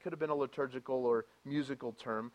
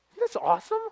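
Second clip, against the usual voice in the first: much higher, loud burst of wonder; excited exclamation; 10 dB louder, faster than usual. Real, not acted. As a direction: shout it out in delight. Exclaiming in awe.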